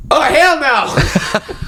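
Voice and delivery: indignant deli guy. Retold or imitated. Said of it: "He goes".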